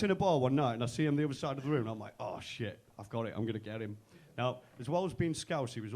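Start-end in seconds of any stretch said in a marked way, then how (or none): 2.2-4.0 low, quiet aside to himself; cicha myśl do siebie. Retold or imitated. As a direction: mutter a sneaky plan low to yourself. Plotting to himself across the bar.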